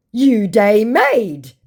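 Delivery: very slow, loud, high impression; mock indignant scammer. Scammer accusing the baiter of madness, mock indignant. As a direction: stretch each word in mock outrage. Acting scammer's pidgin insult.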